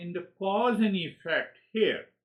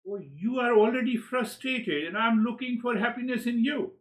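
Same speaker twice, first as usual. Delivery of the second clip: sudden realization; 9 semitones higher, 4 dB louder than usual. Retold or imitated.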